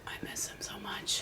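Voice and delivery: mock mournful whisper. Whispering to cohosts, mock heartbroken over a missing wrestler. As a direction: sigh it out like a heartbroken fan.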